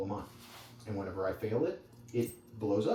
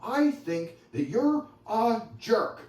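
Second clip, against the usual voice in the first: higher, louder, a touch of an accusing wife; lightly voiced wife listing complaints; upset wife; 7 semitones higher, 5 dB louder than usual. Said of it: "she comes in and she's like".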